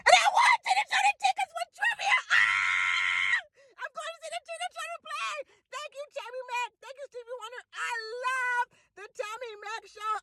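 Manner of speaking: very high, shrieking cry of joy; overjoyed, can't contain it; ecstatic shrieking. Real, not acted. Winner shrieking her thanks to the radio station, very high. Screaming joy after winning tickets.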